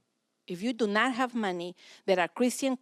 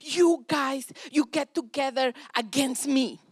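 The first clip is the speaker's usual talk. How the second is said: imitating a mad teen, higher and louder; mad son; 6 semitones higher, 5 dB louder, slower than usual. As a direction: whine that the parents gang up on you. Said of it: "Mark was really mad at".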